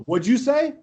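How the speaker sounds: high-pitched, incredulous challenge; retold challenge. Player to the kid who insulted him, retold challenge. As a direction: call out the insult you just heard.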